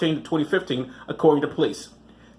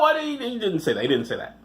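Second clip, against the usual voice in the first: ordinary talk, nothing marked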